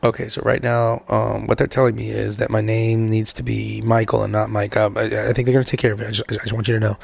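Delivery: very low imitation of stressed calm; Mike. Husband trying not to stress wife, deep calm imitation. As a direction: drop deep and carefully measured, mimicking his hidden stress. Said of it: "when, the way you talk".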